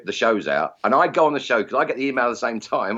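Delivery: normal talking voice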